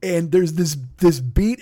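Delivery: ordinary talk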